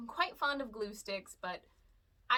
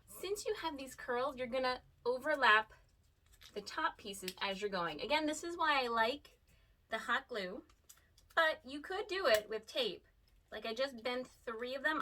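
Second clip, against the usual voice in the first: usual speaking voice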